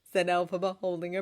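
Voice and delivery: usual speaking voice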